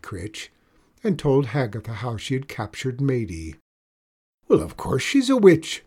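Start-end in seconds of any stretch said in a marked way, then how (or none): 4.5-5.8 high, loud, impatient snap; old witch snapping at her friend's blindness; snapped. A narrator's reading. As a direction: cut her off sharply. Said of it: "snapped Hagatha".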